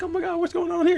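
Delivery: high, quiet bewildered voice; confused pageant winner voice. Retold or imitated. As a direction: squeak it out, confused. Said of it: "She's like".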